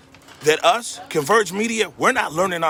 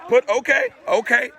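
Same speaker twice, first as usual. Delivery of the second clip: urgent plea; 7 semitones higher than usual. Real, not acted. Gun drawn in street.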